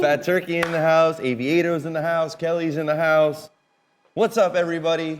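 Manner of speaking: high, bright hype call-out; hype roll call. Pretend. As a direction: shout out each name like an MC.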